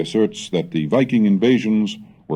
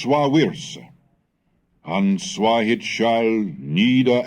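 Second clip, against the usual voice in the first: fiery sermon; 7 semitones higher, slower than usual. Acted. Wulfstan warning the English of the world's end, fiery preaching. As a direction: proclaim doom like a bishop in the pulpit.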